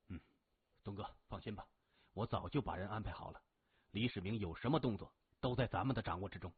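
low, quiet, brisk report; assured henchman. A narrator's reading. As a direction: reassure the boss quickly and softly. Henchman reassures his boss. (低声快速、沉稳地让老大放心)

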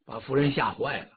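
ordinary delivery

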